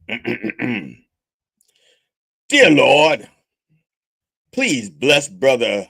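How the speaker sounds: mock sermon prayer; preacher prayer. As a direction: intone it like a preacher. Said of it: "prayer delivery".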